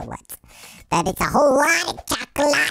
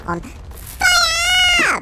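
high, slow, drawn-out goofy yell; silly shout. Real, not acted. Gamer jokes about the torches, high goofy yell. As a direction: stretch it out high like a cartoon.

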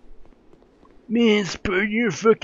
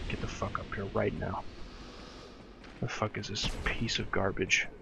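low, much softer threat under the breath; muttered threat; 5 semitones lower, 14 dB quieter than usual. Real, not acted. Player quietly threatening the enemy to come closer. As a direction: grumble it to yourself.

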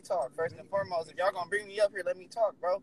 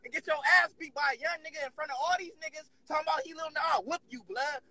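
heated threat in a raised pitch; irate; 4 semitones higher, 4 dB quieter than usual. Real, not acted. Caller threatening to pull up on a rival, irate. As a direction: threaten angrily without adding volume. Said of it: "Why you so irate and mad at me?".

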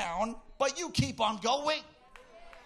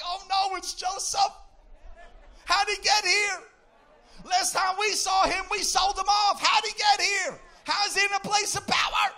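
mock panic; 6 semitones higher than usual. Brothers panicking at seeing Joseph in power, hammed-up fear. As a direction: play the brothers losing it in alarm. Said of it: "His brothers were so afraid".

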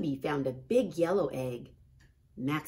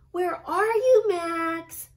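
much higher, much louder call across the garden; 13 semitones higher, 10 dB louder, slower than usual. A narrator's reading. Ruby searching the garden for Max, calling out much higher and louder. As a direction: shout out for someone hiding. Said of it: "Ruby called".

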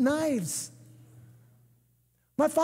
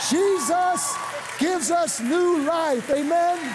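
slow, drawn-out preacher's cry; raised-pitch, stretched-out praise; rousing proclamation; 4 semitones higher, slower than usual. Real, not acted. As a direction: proclaim it to a cheering congregation. Preacher rouses cheering congregation.

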